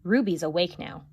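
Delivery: usual speaking voice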